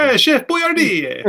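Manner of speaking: high, slow hammy Italian voice; Italian accent. Pretend. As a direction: draw it out like a cartoon Italian chef. Joking Italian voice after mob bit.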